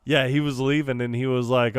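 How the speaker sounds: ordinary talk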